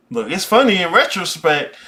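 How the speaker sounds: ordinary talk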